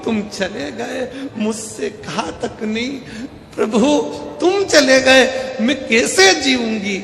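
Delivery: acted loud weeping, words stretched; mock wailing wife. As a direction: wail like a bereaved wife, hammed up. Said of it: "पत्नी धाड़ धाड़ के रोने लगी".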